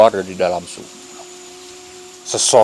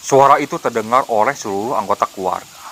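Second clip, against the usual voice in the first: ordinary delivery, nothing marked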